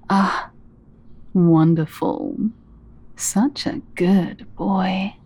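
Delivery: ordinary delivery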